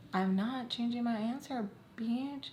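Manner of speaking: soft, defiant muttered retort; defiant aside. Retold or imitated. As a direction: refuse her quietly, as if just out of earshot. Acted defiant reply under her breath.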